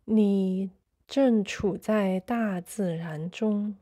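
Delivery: normal talking voice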